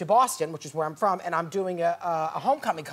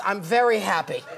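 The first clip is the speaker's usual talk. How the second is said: ordinary talk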